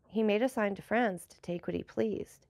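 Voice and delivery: ordinary delivery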